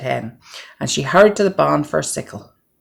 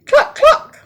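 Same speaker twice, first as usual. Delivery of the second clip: high, loud clucking call; hen clucking call; 7 dB louder than usual. Acted. Hen calls her chicks to the grain, high clucking. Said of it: "called the little red hen".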